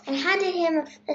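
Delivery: ordinary delivery, nothing marked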